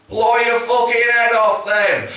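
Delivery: high, slow, drawn-out yell; shouted heckle. Retold or imitated. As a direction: bellow it across an arena at the singer. Heckling the singer at a concert.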